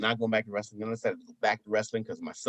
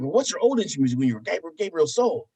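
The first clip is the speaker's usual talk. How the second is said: higher, louder kid imitation; curious son; 5 semitones higher, 4 dB louder than usual. Son asking about old wrestling music, higher kid voice. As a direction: press the question like an eager child. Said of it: "He's like".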